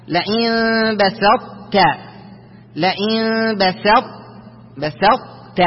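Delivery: slow "لئن بسطت", heavy ط held, released as ت; heavy incomplete ط merger. Shown as a demonstration. Teacher demonstrates partial assimilation, ط stays heavy into ت. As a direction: hold the ط of بسطت without bounce, then release ت. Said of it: "مفخم أو مستعلي".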